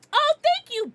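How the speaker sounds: high loud thanks; thrilled high thank-you; delighted thanks. Real, not acted. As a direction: gush with surprise. Thanking a chatter.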